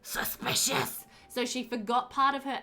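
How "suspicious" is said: slow, drawn-out stage whisper. Pretend. Player reacts to a forgotten alibi, mock suspicious whisper. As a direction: whisper it slowly, mock-conspiratorial.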